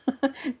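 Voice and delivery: short light chuckle; laugh. Real, not acted. Chuckles about babysitting remark.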